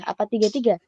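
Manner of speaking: ordinary talk, nothing marked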